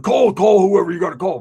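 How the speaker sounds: loud challenging yell; mock defiant shout. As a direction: dare him to call, loudly. Friend daring him to verify.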